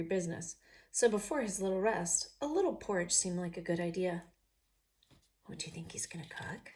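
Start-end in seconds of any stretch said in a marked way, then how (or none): 5.5-6.7 unvoiced whispered aside. Real, not acted. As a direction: lean in and whisper the question.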